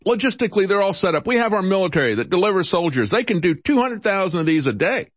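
high-pitched, eager mock boast; Trump. Host mimicking the President on military logistics, high-pitched eager boast. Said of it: "says Trump".

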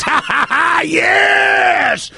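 high shrieking witch cackle and cry; cackling witch. Retold or imitated. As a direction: shout it like a gleeful cartoon witch. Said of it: "and the witch goes".

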